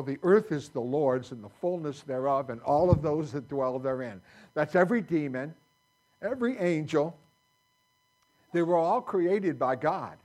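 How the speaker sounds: loud proclamation of scripture; full-force preaching. Real, not acted. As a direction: preach it out, punching every phrase.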